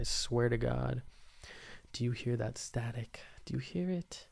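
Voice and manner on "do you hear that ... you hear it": hushed whisper, listening hard. Real, not acted. Host asking listeners about the static, whispering. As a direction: whisper it as if straining to hear a sound.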